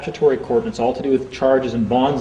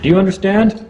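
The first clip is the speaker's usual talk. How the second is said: a judge's question, higher and louder; mock judge; 7 semitones higher, 4 dB louder than usual. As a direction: ask it like a stern court official. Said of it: "the word understand in statutory court".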